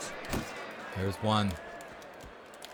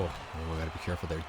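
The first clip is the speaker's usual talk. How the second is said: tense hushed voice; 7 semitones lower, 11 dB quieter, faster than usual. Real, not acted. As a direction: drop to a soft, low hush. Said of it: "I don't know why I'm whispering".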